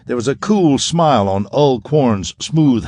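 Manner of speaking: ordinary delivery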